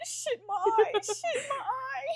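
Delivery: very high mock Irish wail; laughing, high-pitched Irish-accent imitation. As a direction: mimic a hurt coach in an Irish accent. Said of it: "in her Irish accent".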